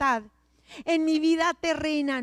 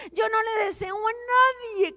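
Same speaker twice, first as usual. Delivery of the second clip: emphatic shout; 7 semitones higher, 5 dB louder than usual. Real, not acted. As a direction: cry out the warning, then drop. Emphatic warning to congregation. (advertencia gritada que se apaga)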